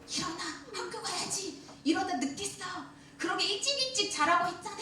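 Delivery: hammed-up morning nagging from mom; nagging mother. Pastor imitates a mother scolding her late child.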